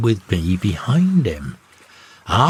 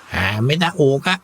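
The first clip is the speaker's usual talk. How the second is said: higher grunting parrot voice; 5 semitones higher than usual. A narrator's reading. Parrot grunts at the second mate at dinner, higher grunting tone. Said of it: "says in a grunting tone".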